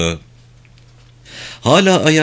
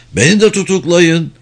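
higher, mildly raised narrated cry; crowd roar; 6 semitones higher, 4 dB louder than usual. A narrator's reading. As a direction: voice a swelling crowd's demand with restraint. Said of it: "Ses artık bir kükreme haline almıştı". (ölçülü bir kalabalık kükremesi)

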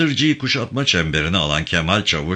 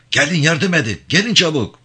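frantic call; 9 semitones higher, 4 dB louder than usual. A narrator's reading. Frantic digging in the rubble.

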